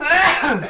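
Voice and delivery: second sharp sneeze; loud sneeze. Real, not acted. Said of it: "Sorry, I sneezed really loud".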